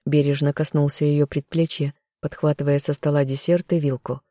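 plain reading voice

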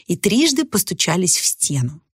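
ordinary delivery

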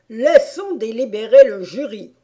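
loud, emphatic royal order; proclaiming king. A narrator's reading. The king concluding for the twentieth time, proclaiming. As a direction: announce it loudly to the whole court. King's repeated order to the court.

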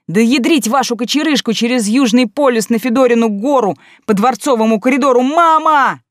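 loud, rising panicked cursing; panicked yelling. A narrator's reading. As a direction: curse wildly while dodging. Heroine cursing while dodging.

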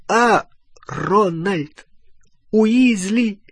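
high, angry, each word a separate blow; slow, high-pitched scolding in bursts; furious word by word. A narrator's reading. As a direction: punch out every word on its own with pauses. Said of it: "Каждое слово сопровождалось ударом".

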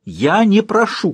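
громкий медленный крик; выкрикнуть с вызовом. Acted. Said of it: "кричала она". (кричит, требуя своего)

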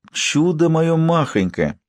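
put-on high, slow cooing old nanny; doting old nanny. A narrator's reading. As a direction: coo over the baby. Doting nanny coos over baby.

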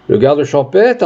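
plain reading voice

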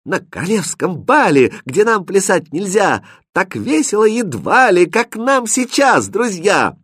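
put-on high, lilting girl's verse; sing-song it high to the dance; Катринка поёт. A narrator's reading. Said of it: "Потом запела Катринка". (высоко и нараспев, по-девичьи)

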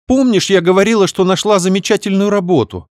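high sheepish sister voice; guiltily mumbled. Acted. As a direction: sound guilty, wheedling. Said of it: "виновато промямлила сестра". (виновато, высоким голосом)

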